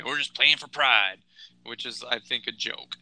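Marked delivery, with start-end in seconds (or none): 0.0-1.2 slow, mocking quote of the cliche. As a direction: drag out the tired cliche with scorn. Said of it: "It's the old cliche".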